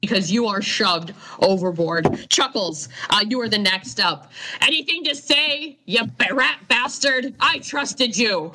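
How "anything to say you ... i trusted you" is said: betrayed captain. Acted. Captain accuses Chuckles before tossing him.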